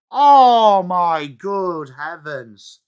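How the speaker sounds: slow stretched groan of disbelief; drawn-out disbelief. Real, not acted. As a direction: draw it out, unable to believe it. Disbelief at a knock-over.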